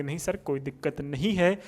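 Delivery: student reply. Pretend. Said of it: "आप बोलोगे".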